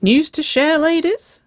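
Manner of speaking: high, loud, rising tease; amused, teasing question. A narrator's reading. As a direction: call it out with playful curiosity. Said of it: "Curtis laughed".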